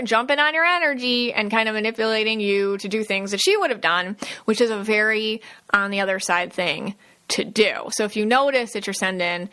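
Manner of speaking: normal talking voice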